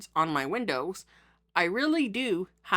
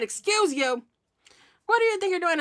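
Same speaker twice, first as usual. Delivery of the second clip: retold outraged scold, much higher; 10 semitones higher than usual. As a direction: call out the startled passerby. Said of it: "as soon as I said".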